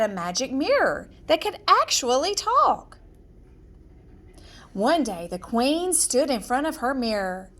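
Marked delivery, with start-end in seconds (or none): none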